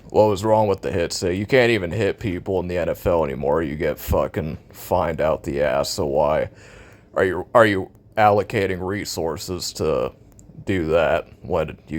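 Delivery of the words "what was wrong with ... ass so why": normal talking voice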